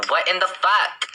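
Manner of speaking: escalating exasperation. Real, not acted. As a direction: rise up in outrage on it. Repeated, escalating disbelief.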